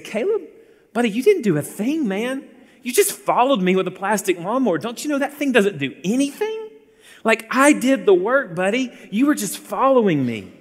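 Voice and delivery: a hammed-up condescending father; dismissive dad. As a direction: belittle the toddler, pretend scorn. Mock dismissive dad, pretend.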